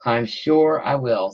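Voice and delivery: ordinary talk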